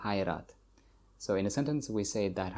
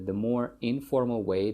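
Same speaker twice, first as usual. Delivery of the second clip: ordinary delivery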